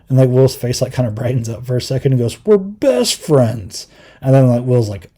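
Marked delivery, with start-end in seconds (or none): 2.4-3.9 high, drawn-out punchline; mock excited reveal. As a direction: stretch it out high, like a big reveal. Acting out the show's punchline.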